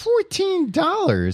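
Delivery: very high, drawn-out squawk of disbelief; shocked disbelief. Real, not acted. Friend hears the beer price, shocked disbelief. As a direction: stretch it out way up high, aghast.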